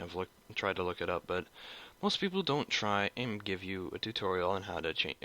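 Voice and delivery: normal talking voice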